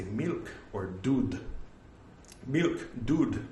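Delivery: milk and dud in an Indian accent. Shown as a demonstration. Showing what shopkeepers understand, Indian accent. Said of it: "I have to say milk".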